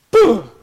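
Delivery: loud punchy "Boom!"; loud sound-effect "Boom!" for the buck. Retold or imitated. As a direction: punch the word out like a crash. Horse bucks him off.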